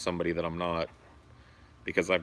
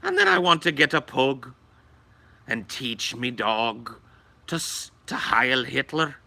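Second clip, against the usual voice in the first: mock Irish; 6 semitones higher than usual. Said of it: "that accent is really the hardest".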